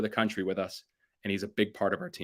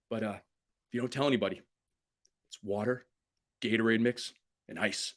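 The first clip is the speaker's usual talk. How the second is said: softer, sharing a secret recipe; confiding, lowered voice; secretive hush; 5 dB quieter than usual. Retold or imitated. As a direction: lean in and tell it quietly. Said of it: "He goes".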